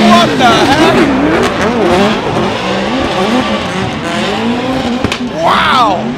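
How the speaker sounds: surprised. Real, not acted. Reacting to car climbing over.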